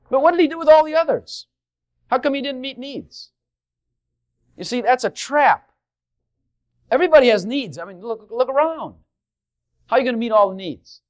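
high, loud sermon climax; fiery preacher pressing his flock; preaching at full force. Acted. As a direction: drive the point home to the congregation. Preaching builds.

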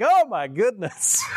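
high, drawn-out exclamation breaking into laughter; amazed laughter. Retold or imitated. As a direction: laugh it out in astonishment, slow and high. Retells his amazed reaction, laughing.

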